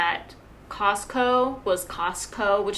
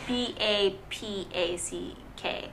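softer, drawn-out letters; slow spelling back; 8 dB quieter, slower than usual. Real, not acted. Friend checking the spelling, slow and softer.

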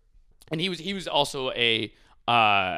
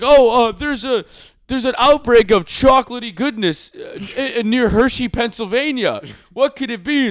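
mock news anchor; 10 semitones higher, 9 dB louder than usual. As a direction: play a wide-eyed, overacted newsman, pitched up and loud. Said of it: "he's like".

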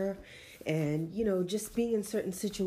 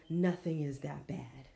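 ordinary delivery

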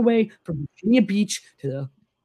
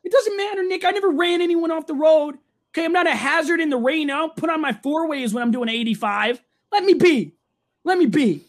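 pitched-up protest at a teasing friend; defensive squeal; 7 semitones higher than usual. Real, not acted. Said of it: "you're ranting about this".

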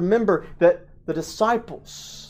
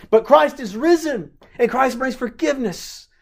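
high, loud joyful proclamation of Christ risen; rising proclamation; 9 semitones higher, 4 dB louder than usual. Real, not acted. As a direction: lift the voice in triumph. Sermon climax proclamation.

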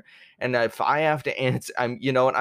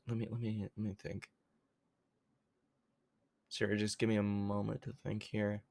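quiet muttering; 12 dB quieter, faster than usual. Real, not acted. Thinking aloud, quiet.

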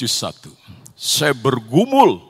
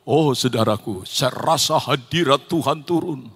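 ordinary delivery

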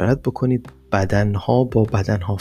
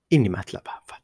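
normal talking voice

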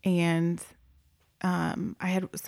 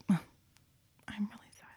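slow, breathy whisper, much softer; whispered sadness; 3 semitones higher, 10 dB quieter, much slower than usual. Retold or imitated. Girls telling the foster worker goodbye, slow whisper. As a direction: mimic the girls sadly whispering it. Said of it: "they both were like".

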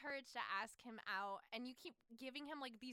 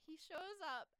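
ordinary delivery

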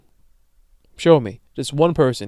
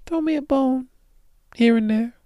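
much higher, begging whine; pleading; 12 semitones higher than usual. Real, not acted. Begging listeners for a favor, high pleading voice. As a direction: beg it in a high voice.

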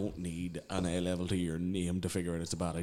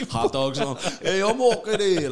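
loud New Yorker voice; 11 semitones higher, 12 dB louder, slower than usual. Pretend. Imagined man arriving in New York.

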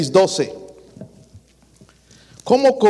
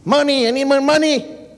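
ruego al jefe en inglés chapurreado, algo ligero; suplicando al patrón; 5 semitones higher than usual. Retold or imitated. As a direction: pide más dinero al patrón en broma. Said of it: "Sabemos hablar con el patrón". (imitando al trabajador que le pide dinero al patrón, en tono de ruego)

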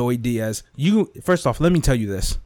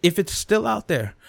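ordinary talk

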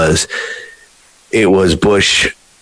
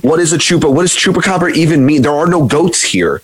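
higher, exasperated protest; exasperated friend; 7 semitones higher, faster than usual. Retold or imitated. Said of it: "You'd be like".

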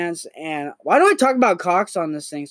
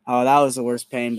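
ordinary talk

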